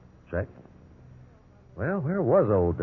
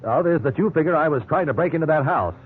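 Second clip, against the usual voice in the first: higher, louder exasperated; indignant protest; 6 semitones higher, 3 dB louder than usual. Acted. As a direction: push back, worked up. Suspect indignant in interrogation.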